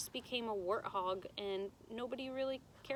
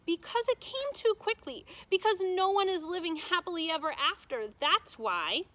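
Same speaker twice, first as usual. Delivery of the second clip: put-on loud, high insistent child; a girl arguing with her grandmother; upset girl protesting; 8 semitones higher, 7 dB louder than usual. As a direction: push back hard against the ending. Abilene protesting the ending.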